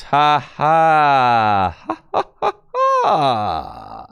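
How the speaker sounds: loud, high, drawn-out gloating laugh; over-the-top victory laugh; triumphant cackle. Real, not acted. Gloats at learning new trick.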